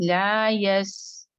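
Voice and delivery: لا یس drawn very slowly with the س air trailing off; letting the seen hiss. Shown as a demonstration. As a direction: hold یس and let the air fade on its own. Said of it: "تو ایسے ہوگا". (لا یس میں سین کی ہوا آہستہ ختم ہونے دیں)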